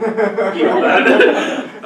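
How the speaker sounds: laughing loudly through the words. Real, not acted. Joking to the workshop about grandma's recipe, laughing out loud.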